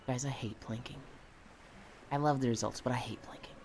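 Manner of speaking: hushed unvoiced whisper. Real, not acted. Griping to chat about planking, whispered. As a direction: breathe it out as a secret.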